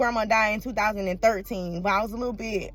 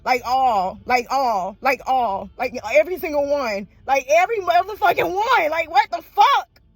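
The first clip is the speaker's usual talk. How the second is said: loud, rising exasperated plea; imitating an exasperated plea to God, building louder; questioning God; 4 semitones higher, 4 dB louder than usual. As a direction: demand answers from God, getting louder. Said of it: "sometimes I be questioning God".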